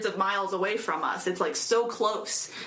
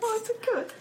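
cooing; 13 semitones higher than usual. Real, not acted. Cooing over sheeplets.

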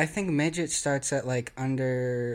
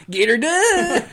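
much higher, louder laughing catchphrase; Larry the Cable Guy; 9 dB louder, slower than usual. Pretend. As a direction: shout it like a hammed-up country comic. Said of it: "Larry the Cable Guy".

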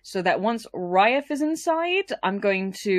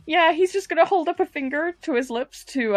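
ordinary delivery

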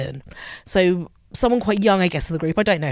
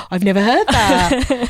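gasp, then higher, louder, drawn-out astonishment; mock amazement; 4 semitones higher, 5 dB louder, slower than usual. Group member from Spain hearing a new idiom, amazed. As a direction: imitate someone amazed by a new phrase, slowly.

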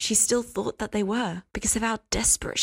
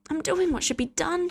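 higher, sugary and sweet; syrupy; 9 semitones higher than usual. A narrator's reading. Hester answering Cass, higher and syrupy sweet. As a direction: coat it in syrup. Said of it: "her voice syrupy".

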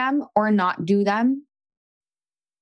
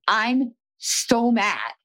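slow drawn-out mad; 4 semitones higher, much slower than usual. Shown as a demonstration. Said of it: "I just be like".

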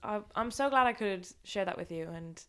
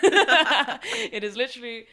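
laughing; 5 semitones higher, 10 dB louder, slower than usual. Real, not acted. Laughing at the scouse stew.

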